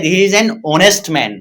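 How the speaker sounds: slow, deliberate he is an honest man; teacher slowly modelling an honest man; slow model sentence. Shown as a demonstration. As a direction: say it slowly to show the vowel sound. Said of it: "honest, ओ की sound".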